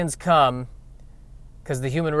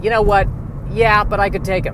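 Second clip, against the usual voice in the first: cocky lizard brain; 7 semitones higher, 4 dB louder than usual. Pretend. Lizard brain voice sizing up rival.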